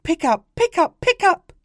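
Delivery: very high, loud, repeated squeals; excited toddler. Acted. Little boy begging his father, excited squeal. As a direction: demand it like an excited little child.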